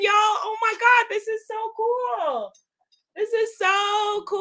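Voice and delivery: high-pitched, drawn-out awe; giddy, high-voiced amazement; real thrill. Real, not acted. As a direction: gush in high, stretched words. Thrilled seeing French version.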